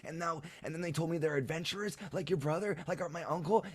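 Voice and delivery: put-on high-pitched child rambling breathlessly on; Leuven. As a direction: gush out the adventure in a little kid's voice. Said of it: "And he says".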